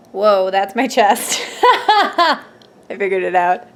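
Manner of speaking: startled laugh breaking through the words; laughing as the cat lands on her chest. Real, not acted. Vlogger startled by her cat, laughing.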